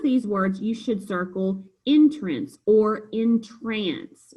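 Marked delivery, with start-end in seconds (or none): 1.9-2.6 ENTRANCE stressed on the first syllable, IN-truns. Shown as a demonstration. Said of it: "When we pronounce it as entrance". 3.1-4.3 "entrance" as in-TRANCE, stressed on the end; full, drawn-out second syllable in "entrance". Shown as a demonstration. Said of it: "when we pronounce it as entrance".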